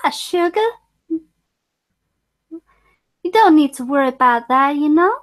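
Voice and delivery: a hint of a sweet Southern belle; imitating Rogue's Southern accent, lightly; Southern belle impression. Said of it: "the way she used to speak was".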